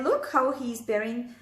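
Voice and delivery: ordinary delivery, nothing marked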